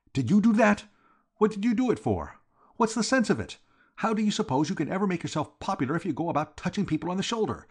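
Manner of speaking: high-pitched nervous scolding; jumpy outburst. A narrator's reading. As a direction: jump out of your skin and scold. Startled George rounds on Reggie.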